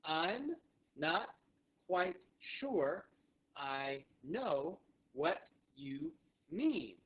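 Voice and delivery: very slow, word by word; spaced-out teaching delivery; slow repeat-after-me model. Shown as a demonstration. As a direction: say it slowly for learners to join in, pausing between words. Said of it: "So one more time together".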